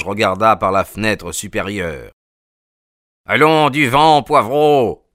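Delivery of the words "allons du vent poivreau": loud, high angry yell from a window; gruff old man shouting down from upstairs; growling shout. A narrator's reading. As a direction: bark at the intruder to clear off. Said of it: "gronda une voix".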